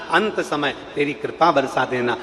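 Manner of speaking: low, softened prayerful pleading; humble plea. Acted. Devotee asking Baba for grace at life's end, soft plea.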